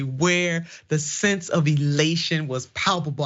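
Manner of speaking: usual speaking voice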